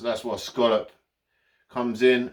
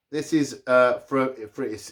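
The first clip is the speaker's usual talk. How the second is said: ordinary talk, nothing marked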